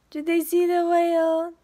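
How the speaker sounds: high and loud, thrilled; excited gasp and cry. Real, not acted. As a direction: burst out with delight at the climax. Climax of the story.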